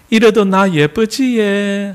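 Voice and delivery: high, slow Gyeongsang coaxing; Gyeongsang woman. Acted. Gyeongsang woman wanting affection from her man, Gyeongsang wheedling. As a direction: beg a compliment in drawn-out Gyeongsang lilt. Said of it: "경상도 여자".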